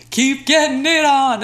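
[name] voice. Retold or imitated. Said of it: "he's just like".

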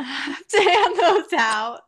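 loud laugh-filled words. Real, not acted. Joking to her cohost about handing them out, laughing loud. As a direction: crack up on the last words.

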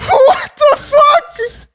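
high, loud, drawn-out cry; appalled disbelief. Real, not acted. Listener reacts to the poop rule, long appalled cry. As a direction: wail it slowly in disbelief.

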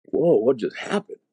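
retold amazement. Man suddenly sober on the church floor, mildly acted amazement. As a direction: act out being stunned, voice lifted. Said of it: "I'm blown away".

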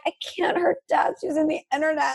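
high, half-whispered excuse breaking into laughter; laughing excuse. Retold or imitated. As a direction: fake an excuse while trying not to laugh. Lame excuse told to dad.

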